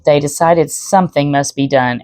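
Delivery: ordinary delivery, nothing marked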